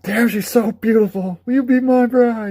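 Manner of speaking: high, loud lovestruck voice; catcalling admirer. Retold or imitated. Men whistling at Cloud in a dress: high smitten catcall. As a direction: swoon over her like a smitten stranger. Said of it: "people are whistling at you".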